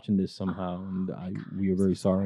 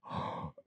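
sharp gasp; 12 dB quieter than usual. Retold or imitated. Shocked reaction acted out.

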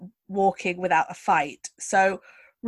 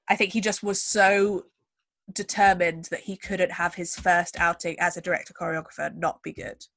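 normal talking voice

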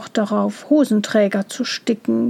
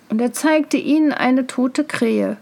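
ordinary delivery

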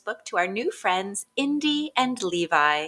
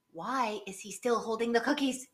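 usual speaking voice

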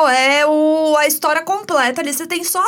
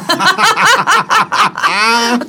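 bright burst of laughter; 4 semitones higher, 5 dB louder than usual. Real, not acted. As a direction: laugh out loud. Laughing at nickname.

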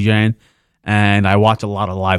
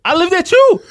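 squeaky, pitched-up retelling of his own line; high mock voice; 4 dB louder than usual. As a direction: jump way up into a thin mock voice. Retold quote, high far.

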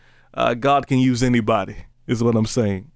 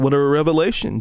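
ordinary talk, nothing marked

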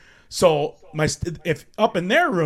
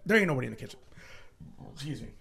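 ordinary talk

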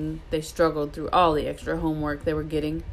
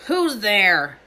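raised, louder voice through the door; calling out; 4 semitones higher, 6 dB louder than usual. A narrator's reading. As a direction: call out to whoever is knocking. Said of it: "Hagrid called out".

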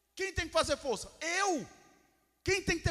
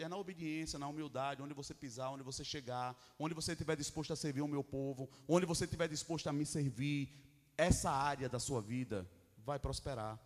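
low, soft voice of God; quiet, lowered divine counsel; God's gentle instruction; 8 semitones lower, 4 dB quieter than usual. Acted.

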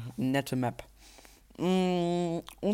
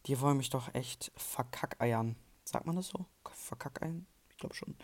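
whispering; 8 dB quieter than usual. Real, not acted. Whispered aside while gaming.

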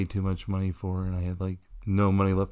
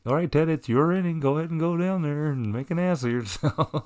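teasing coworkers; 7 semitones higher than usual. Retold or imitated. Imitating teasing fellow ushers.

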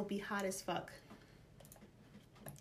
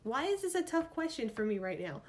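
ordinary delivery

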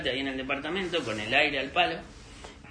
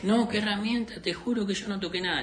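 higher-pitched protest of innocence; mock protesting denial; 6 semitones higher than usual. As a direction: lightly act out the accused man's denial. Acting accused neighbour's denial.

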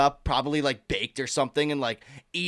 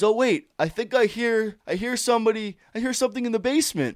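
dad voice; 7 semitones higher than usual. Retold or imitated. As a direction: play the sly dad teasing the kids. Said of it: "my dad just goes".

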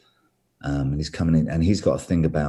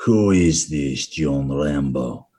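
slow, lower, milder Russian voice; same villain line but dialled down; toned-down Russian accent; 3 semitones lower, much slower than usual. Retold or imitated. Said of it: "I just took it down a few, few levels".

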